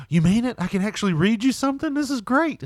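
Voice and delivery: high, eager delight; can't believe his luck; thrilled. Retold or imitated. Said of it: "He's like".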